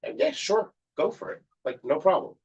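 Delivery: high, easy-going "sure, go for it"; breezy mock reply. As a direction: toss it off lightly, no big deal. Imagined casual reply to favor.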